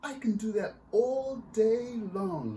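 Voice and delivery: put-on high, loud, slow laughing child; laughing girl. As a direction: laugh it out while flying in the air. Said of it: "laughed Astrid".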